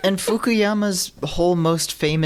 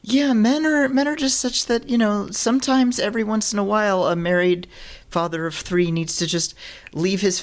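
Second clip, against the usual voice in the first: higher-pitched mock quote of the author; mock male author; 7 semitones higher than usual. Podcaster mimics a self-help author excusing men, mock male author. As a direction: put on a higher voice quoting him. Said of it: "the guy s-, says, like".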